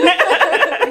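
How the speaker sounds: a quick real chuckle at the memory; light laugh. Real, not acted. Remembering late nights with flatmates, a light laugh.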